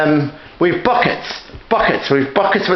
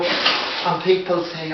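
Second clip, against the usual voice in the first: ordinary talk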